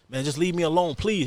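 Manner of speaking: mock frightened begging; scared plea. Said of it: "He was scared".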